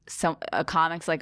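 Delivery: ordinary delivery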